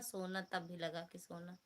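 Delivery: ordinary delivery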